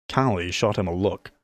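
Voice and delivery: usual speaking voice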